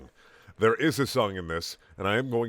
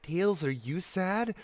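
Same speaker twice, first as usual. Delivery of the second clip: mom voice; 6 semitones higher, 3 dB quieter, slower than usual. A narrator's reading. Mother voiced in story.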